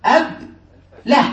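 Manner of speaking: أب له broken apart with a pause; word split in syllables. Shown as a demonstration. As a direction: say أب, pause, then له. يقطّع الكلمة ليبيّن وزنها. (تقطيع أب له إلى مقطعين)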